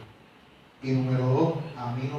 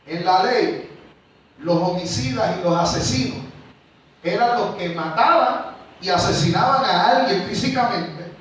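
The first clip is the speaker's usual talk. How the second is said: loud, higher, driving sermon voice; full-force preaching; 6 semitones higher, 9 dB louder than usual. Acted. Preacher driving home the law of Christ to his church, full force. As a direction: hammer the point home to the congregation.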